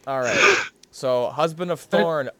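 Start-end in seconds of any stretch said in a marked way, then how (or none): 0.1-0.6 excited. Real, not acted. Kicking off the game.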